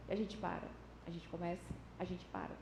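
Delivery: low, very soft, fading away; deflated, sinking almost to nothing; petering out. Acted. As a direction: let it die down, losing steam. Acting out repeatedly giving up.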